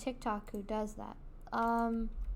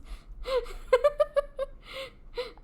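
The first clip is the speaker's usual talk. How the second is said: breathless high cackle; high squealing laugh; 13 semitones higher than usual. Real, not acted. Cracking up after her silly song, high squeal.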